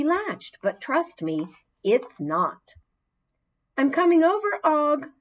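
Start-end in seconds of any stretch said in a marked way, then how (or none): 3.8-5.1 high, loud call across the room; announcing loudly. Acted. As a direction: call out proudly to the frog. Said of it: "I announced".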